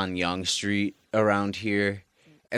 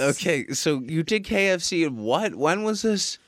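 high-pitched questioning; 8 semitones higher than usual. Pretend. High mock interrogation.

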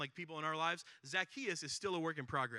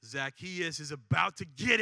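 low mock-gloating chuckle, then slow and louder; gloating crowd; 3 semitones lower, 6 dB louder, much slower than usual. Crowd member gloating over Zacchaeus, low then slow and loud. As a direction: savor someone else's coming trouble. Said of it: "you're thinking".

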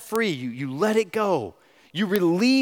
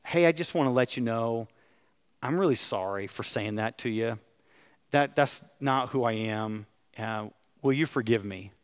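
hushed, sheepish sorry; gentle contrite retelling; humble apology; 6 semitones lower, 6 dB quieter than usual. Retold or imitated. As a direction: softly, lower and quieter, retelling the apology. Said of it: "and I said".

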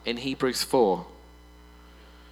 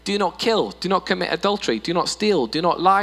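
higher, louder, each NOT punched; emphatic commandments; 6 semitones higher, 5 dB louder than usual. Acted. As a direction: proclaim them firmly.

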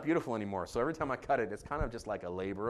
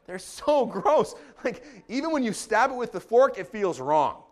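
mock disgust; 8 semitones higher, 8 dB louder than usual. Telling of disgusting mussels.

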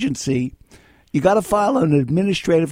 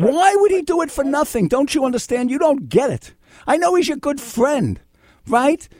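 exasperated; 10 semitones higher than usual. Real, not acted. Host exasperated at stubborn caller.

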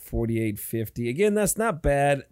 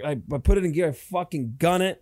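ordinary talk, nothing marked

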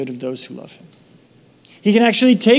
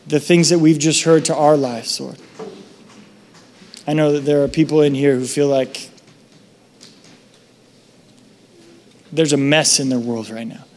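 prayer; 2 semitones lower than usual. Acted. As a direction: pray over the congregation. Leads a prayer.

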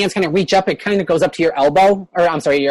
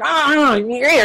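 mildly acted high-pitched cheer; lightly voiced excited crowd; welcoming goblins; 7 semitones higher, slower than usual. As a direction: greet newcomers with a lifted little cry. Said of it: "they all kind of like".

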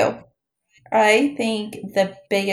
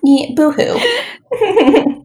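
mock sobbing; 8 semitones higher, 5 dB louder, much slower than usual. Mocking sympathy for player.

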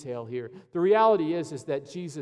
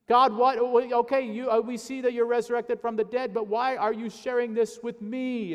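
high, loud disbelieving questions to God; playing Mary baffled at being chosen; bewildered Mary; 8 semitones higher, 5 dB louder than usual. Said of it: "is what Mary would say".

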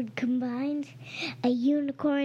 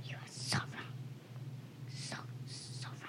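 teasing whisper; 15 dB quieter than usual. Real, not acted. Sister whispers teasingly at her brother, quiet taunt. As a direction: hush it out, mocking and breathy.

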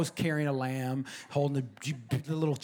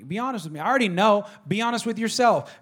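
rising from firm to loud and higher; building insistence; 7 semitones higher, 9 dB louder than usual. Acted. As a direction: push harder each time. Jesus voiced, repeated plea building.

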